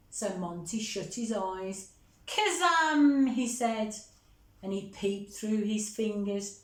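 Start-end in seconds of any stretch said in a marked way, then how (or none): 2.3-3.3 louder and higher magic word; spell call. Acted. Monty casting his spell eyes shut, loud call. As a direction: cast it with eyes shut, grandly.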